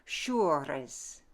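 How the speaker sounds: Frenchify "Showers": long oo, trilled R; Frenchified pronunciation. Shown as a demonstration. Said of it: "we're gonna Frenchify it".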